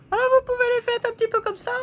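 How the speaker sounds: high doll voice, quick and playful; squeaky figurine voice. Acted. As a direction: make the paper lady chirp as she slides in. Voices figurines while placing them.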